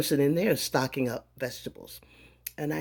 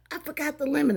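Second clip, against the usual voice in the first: sudden realization; 11 semitones higher, faster than usual. Retold or imitated. Remembering lemon at checkout.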